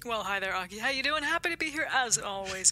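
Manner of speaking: put-on a light American reporter accent. The co-host answers the anchor in a light American accent. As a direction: slip into a mild American field-reporter voice. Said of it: "why am I gone American?".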